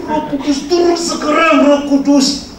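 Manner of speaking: high-pitched pleading call upward; insistent preacher call. Acted. Pastor calls Spirit down again.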